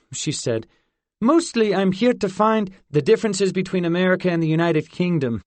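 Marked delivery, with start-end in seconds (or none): 1.2-5.4 fast, higher, louder cover story; said quickly. A narrator's reading. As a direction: rush the excuse before she speaks. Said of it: "Fort said quickly".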